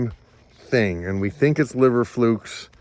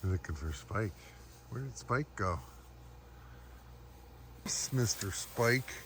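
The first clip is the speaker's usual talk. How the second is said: talking to a dog; 16 dB quieter than usual. Real, not acted.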